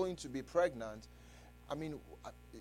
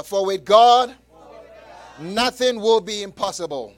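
much louder, shouted on God; 3 semitones higher, 12 dB louder than usual. Shown as a demonstration. Preacher urges the congregation louder, loud shout. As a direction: raise the whole verse up louder. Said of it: "Say it a little bit louder".

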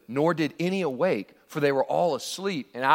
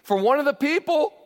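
taunting call; 7 semitones higher, 3 dB louder than usual. Acted. David taunting Abner from afar.

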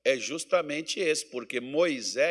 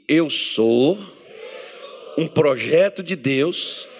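louder, slow, bold proclamation; loud declaration of faith; 5 dB louder, slower than usual. Shown as a demonstration. Pastor having the congregation declare it, loud and slow. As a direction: proclaim it for the whole church to repeat. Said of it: "Então diga assim".

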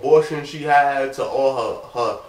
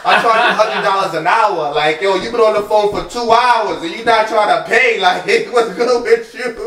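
higher, louder teasing with a laugh; laughing mock complaint; 6 semitones higher, 8 dB louder than usual. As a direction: joke about billing her, half-laughing. Mock demand for payment, laughing.

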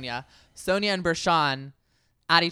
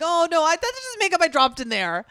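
higher, louder mock-innocent excuse; imitating Ramona; 13 semitones higher, 6 dB louder than usual. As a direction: play her as a caught housewife feigning innocence. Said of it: "she's like".

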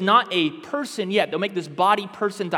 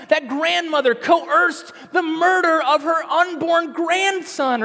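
higher, louder, slower preaching at full force; impassioned preaching; 8 semitones higher, 5 dB louder than usual. Acted. Preacher condemns the grandmother to his congregation, impassioned preaching. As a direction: thunder the outrage at the congregation.

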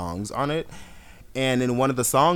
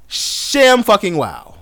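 slow, loud, high sarcastic wow; mock amazement; 9 semitones higher, 12 dB louder, slower than usual. Real, not acted. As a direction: stretch it out in fake astonishment.

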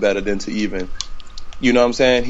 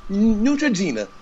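announcer voice; 11 semitones higher than usual. Pretend. Mock sponsor read after radio bit.

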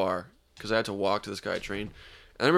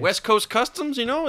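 high goofy voice; 12 semitones higher than usual. Acted. Joking in high put-on voice.

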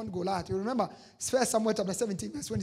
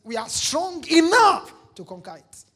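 louder, higher, confident; bold declaration; 6 semitones higher, 8 dB louder than usual. Shown as a demonstration. Preacher showing the congregation how to speak, bold and loud. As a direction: say it like you mean it. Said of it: "You need to learn to talk like this".

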